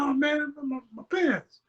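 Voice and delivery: high-pitched mock complaint; high retold protest; mock upset. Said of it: "he kinda got high".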